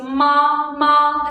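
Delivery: long, level "ma"; steady, drawn-out "ma", no rise or fall; first tone. Shown as a demonstration. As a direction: hold "ma" on one straight pitch. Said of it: "this is how the first tone sounds".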